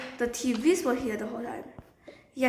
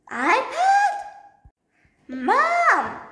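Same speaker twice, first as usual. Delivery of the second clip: loud, very high shriek; panicked yell for Mom; 12 semitones higher, 6 dB louder, much slower than usual. Acted. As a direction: yell for your mother in alarm. Child yells for mother.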